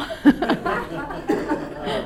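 high breathy giggle; a delighted high laugh. Real, not acted. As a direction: giggle at the joke. Reacting to joke title.

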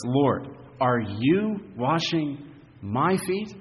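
slow protest, "you" and "my" pushed up in pitch; stress on YOU and MY. Acted. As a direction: lean incredulously on "you" and "my". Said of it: "both the you and the my are, are emphasized".

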